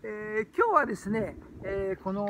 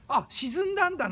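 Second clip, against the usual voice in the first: higher-pitched mock "oh, I see" surprise; mock realisation; 9 semitones higher, 4 dB louder than usual. Imagined listener misreading "think" as sinking, mock surprise. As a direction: play a listener jumping to the wrong meaning. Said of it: "なんて思う人は".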